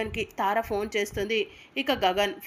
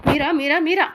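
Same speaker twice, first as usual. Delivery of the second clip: sharp, louder calls of the name; irritated anger; 6 semitones higher, 9 dB louder than usual. A narrator's reading. Apoorva storming off irritated at Meera, angry calls. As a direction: snap the name out in exasperation, higher. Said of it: "కోపంగా irritate అవుతూ".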